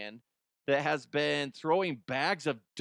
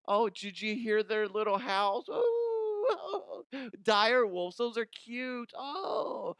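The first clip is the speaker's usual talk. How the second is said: mock gushing; 7 semitones higher, slower than usual. Gushing over dire wolves.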